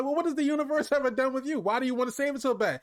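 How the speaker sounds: quick, high, testy raccoon voice; mock Rocket. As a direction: fire off Rocket's jab in a fast high squeak. Rocket quote in argument recap.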